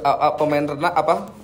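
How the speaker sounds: ordinary talk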